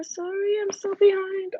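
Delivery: high, whiny mock cry; hammed-up tearful sorry; mock whimper. Apologising for missing comments.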